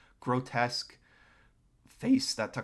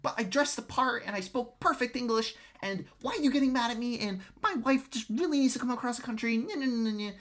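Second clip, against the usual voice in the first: high-pitched mock immigrant whine; whiny angry immigrant; 11 semitones higher than usual. Streamer mimicking an immigrant angry at being refused, whiny and high. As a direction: caricature an aggrieved pleader, much higher than your own voice. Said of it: "they're getting angry that they're like".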